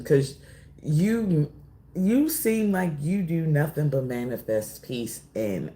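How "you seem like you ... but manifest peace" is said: normal talking voice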